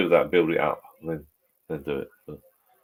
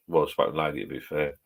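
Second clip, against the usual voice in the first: usual speaking voice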